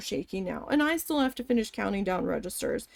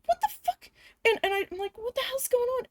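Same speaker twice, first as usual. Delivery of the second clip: high-pitched startled outburst; 13 semitones higher, faster than usual. Retold or imitated. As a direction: voice jumps right up in shock. Scared the fucking shit out of me.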